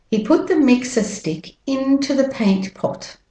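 British-Australian accent, "mixer" ends in "uh". Shown as a demonstration. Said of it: "And with the American accent".

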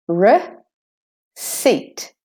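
receipt broken in two, "ruh ... SEET"; syllable by syllable. Shown as a demonstration. Teacher breaks down receipt for learners, syllable by syllable. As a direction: split receipt slowly into a weak "ruh" and a strong "seet". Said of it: "the first E is de-stressed".

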